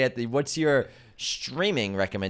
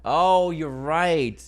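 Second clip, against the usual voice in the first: surprised; 6 semitones higher, 6 dB louder, much slower than usual. Real, not acted. Suddenly remembers the actor.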